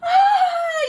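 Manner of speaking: high loud shriek; Granny frightened by the wolf; startled scream. Acted. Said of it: "yelled Granny".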